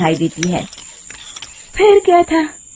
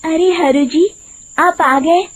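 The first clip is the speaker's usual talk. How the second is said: higher, honeyed woman's voice, luring him in; sweet voice; 6 semitones higher than usual. Acted. Disguised witch sweet-talking Hariya, high honeyed voice. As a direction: sweet-talk the cart driver like a lonely lady. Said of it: "मीठी आवाज में कहती है".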